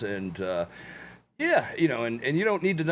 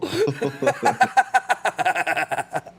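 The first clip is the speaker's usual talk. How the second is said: big high-pitched belly laugh; real uncontrolled laughter; 7 semitones higher, 4 dB louder than usual. Real, not acted. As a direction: crack up loudly. Cracking up at own quote.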